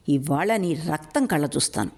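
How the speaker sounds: ordinary delivery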